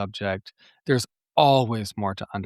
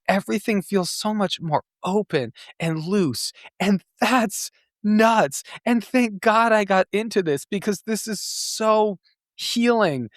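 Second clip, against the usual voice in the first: excited; 10 semitones higher than usual. Real, not acted. Excited about life-changing healing.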